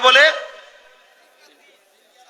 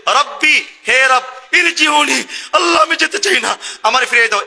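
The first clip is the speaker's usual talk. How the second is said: desperate shout; 6 semitones higher than usual. Acted. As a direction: shout your plea to be sent back. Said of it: "সে বলে".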